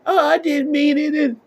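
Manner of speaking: very high, whiny mock plea; high mocking whine of regret. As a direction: whimper it like a fake-sorry kid. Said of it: "Now you're like".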